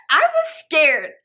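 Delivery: loud, slow, high mock-tearful cry; hammed-up fright. Storyteller reliving the blocked door, loud mock-tearful fright. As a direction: wail it slowly and loudly in exaggerated fear.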